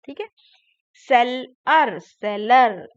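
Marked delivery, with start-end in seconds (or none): 0.9-2.0 seller loud and slow, as sell, ar; broken into syllables. Shown as a demonstration. Teacher breaking a word for children, loud separated syllables in seller. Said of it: "break करके बोला गया है". 2.0-2.9 seller joined into one loud word; syllables blended. Shown as a demonstration. Teacher putting the word back together, loud blended seller. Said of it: "fast बोलते जाएंगे".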